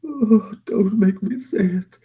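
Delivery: high, loud wail of guilt; crying old master. Acted. Guilt-ridden master to his goblin, crying out. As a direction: cry it out slowly, high and anguished. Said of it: "he's crying this out".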